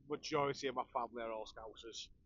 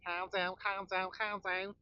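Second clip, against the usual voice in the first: slow Scouse "calm down, calm down"; Scouse accent; 4 semitones higher, 3 dB louder, slower than usual. Shown as a demonstration. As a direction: do the Liverpool catchphrase in a Scouse accent. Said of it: "I can do the Scouse one".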